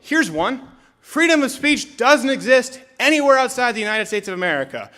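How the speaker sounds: ordinary talk, nothing marked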